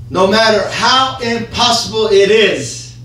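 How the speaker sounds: ordinary talk, nothing marked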